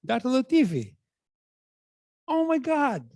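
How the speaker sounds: high, excited exclamation of recognition; joyful recognition. Retold or imitated. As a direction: greet him like a delighted fan. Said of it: "And he's so joyful".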